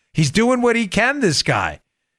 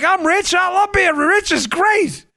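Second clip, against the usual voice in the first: high, exuberant rich-man boasting; Trump; 11 semitones higher than usual. Acted. Host mimics president celebrating being rich, gleeful high voice. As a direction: crow it out in high, punchy bursts. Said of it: "Trump, that's Trump. Trump's like".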